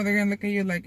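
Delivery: ordinary delivery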